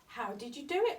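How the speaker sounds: usual speaking voice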